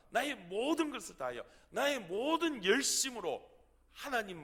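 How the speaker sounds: an exhausted prophet venting his anger at God; angry complaint. Acted. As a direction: cry out in bitter reproach, pleading and accusing. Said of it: "화가 나서 하나님 앞에 원망을 섞인 말로".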